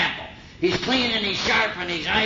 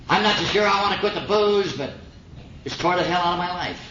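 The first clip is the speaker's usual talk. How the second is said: ordinary talk, nothing marked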